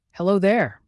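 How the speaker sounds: a lightly voiced river calling out in friendly greeting; burbling river. A narrator's reading. The river greets the floating leaf, lightly bubbly voice. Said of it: "burbled the river".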